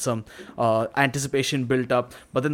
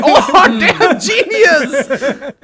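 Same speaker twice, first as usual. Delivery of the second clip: high, loud, drawn-out laughing praise; mock delight; 12 semitones higher, 9 dB louder, much slower than usual. Producers praising the composer's wrong note, laughing. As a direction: gush over the genius idea.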